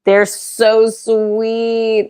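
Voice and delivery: fond exclamation; gushing. Real, not acted. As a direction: gush over how sweet they are. Gushing about kittens.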